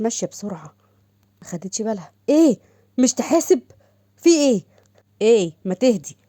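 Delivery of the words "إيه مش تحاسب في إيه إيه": angry snap. Acted. Angry clash after bumping.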